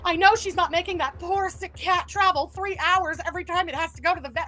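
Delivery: mimicking someone aghast, high and loud; hammed-up indignant scolding; overdramatic outrage. Host mocking an overdramatic YouTube clip about the cat, overdramatic. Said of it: "the overdramatics of the video clip".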